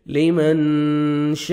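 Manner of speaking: لمن with the ن hidden in the nose, slow; nasal ikhfa. Shown as a demonstration. Teacher shows nasal ikhfa, slow. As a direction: hum the ن of لمن through the nose. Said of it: "نوز میں sound لے کے جائیں گے".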